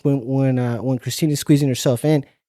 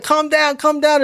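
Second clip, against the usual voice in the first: high mock calming; 13 semitones higher, 4 dB louder than usual. Soothing the upset man.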